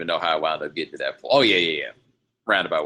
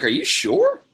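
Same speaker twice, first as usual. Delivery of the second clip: incredulous squeak; 3 dB louder, slower than usual. Retold or imitated. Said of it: "I'm like".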